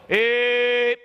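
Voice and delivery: long held "eh" tone; sustained "eh". Shown as a demonstration. Coach showing student the tone to make, sustained "eh". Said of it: "Now go".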